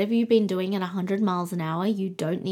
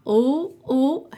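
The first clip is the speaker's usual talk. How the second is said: excited ooh; 6 semitones higher, 6 dB louder than usual. Real, not acted. Reacting to card drawn.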